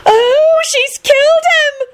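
put-on high, drawn-out girlish wail; happy wail. A narrator's reading. Girl swarming round fallen Lupin, wailing happily. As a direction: wail with thrilled horror. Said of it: "wailed happily".

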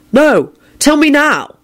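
high, slow insistence; stubborn demand. Acted. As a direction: refuse and demand, drawing each word out. Stubborn nephew demands an answer.

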